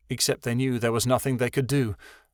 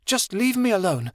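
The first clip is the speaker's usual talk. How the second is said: high, tearful plea to be left alone; tearful girl; 11 semitones higher than usual. A narrator's reading. Chameleon begging Angel to leave her alone, tearful. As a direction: push her away through tears.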